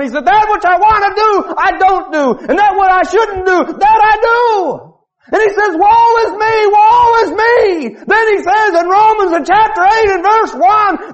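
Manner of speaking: high, loud shouting sermon; preaching at full force. Acted. Preacher to the congregation, shouting fiery preaching. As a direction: lift the verse up like a victory banner.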